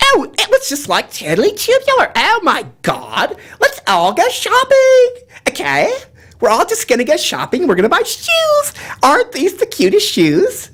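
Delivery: put-on very high, gushing Valley girl voice; California Valley girl accent. Shown as a demonstration. Girl rallying friends to buy shoes, high Valley girl accent. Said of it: "like California".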